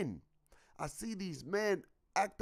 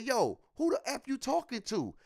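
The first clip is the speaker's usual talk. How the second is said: higher-pitched retold challenge; retold upset confrontation; 6 semitones higher than usual. Retelling his outburst at his partner, upset. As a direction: act out being provoked into cussing. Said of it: "gotta get me really upset".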